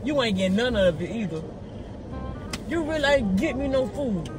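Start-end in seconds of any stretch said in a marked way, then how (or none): none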